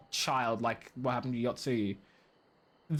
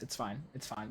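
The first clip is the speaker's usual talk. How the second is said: softer, lower, calming yourself; quiet self-soothing; 5 semitones lower, 9 dB quieter than usual. Real, not acted. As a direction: murmur it to settle down. Calming self after outburst.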